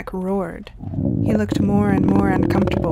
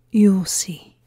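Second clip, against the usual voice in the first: louder, menacing tease; sinister; 4 dB louder than usual. A narrator's reading. Ethan hinting at Maria's fate, sinister. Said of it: "Ethan said with a sinister tone".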